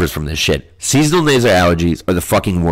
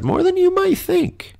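playful high aside. Pretend. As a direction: float it up high, teasingly. Playful aside, pitch jumps before whisper.

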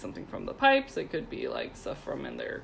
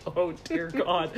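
mock dismay; 2 semitones lower, slower than usual. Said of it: "I'm just listening like".

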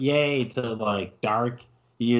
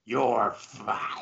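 phlegmy Vince McMahon; 5 dB quieter than usual. Acted. Friend demonstrating the boss's firing line, softly growled with phlegm. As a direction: rattle it softly in the back of the throat. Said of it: "should have a bunch of phlegm".

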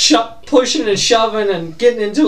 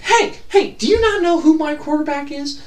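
high-pitched indignant appeal; acted disbelief, pitched up; protesting to referee; 7 semitones higher than usual. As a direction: plead your case to the ref, building at the end. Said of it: "go up to the ref and be like".

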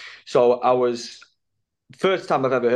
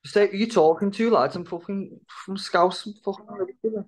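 high-pitched, throaty Scouse; Scouse accent; 8 semitones higher than usual. Shown as a demonstration. Put on the spot for a bit of Scouse, high throaty Scouse accent. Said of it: "Give us a bit of your Scouts".

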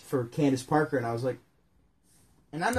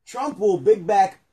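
slow read-aloud; 6 semitones higher, 7 dB louder, slower than usual. Acted. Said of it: "botched read, slow".

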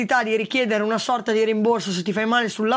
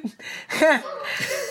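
short amused laugh; 4 semitones higher than usual. Real, not acted. Laughs at the nurse comparison.

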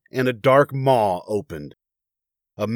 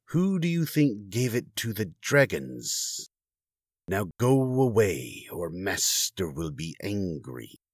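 quiet, slow serpent hiss; hissing Naga; 4 semitones lower, 7 dB quieter, slower than usual. A narrator's reading. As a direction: warn them off in a soft hiss. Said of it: "the Naga said".

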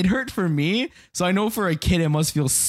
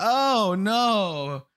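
mock dismay; 6 semitones higher than usual. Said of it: "And I'm like".

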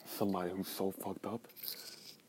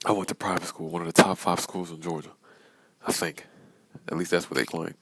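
loud, forceful ranting; 8 dB louder, faster than usual. Real, not acted. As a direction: hammer the point home loudly. Ranting loudly about schools.

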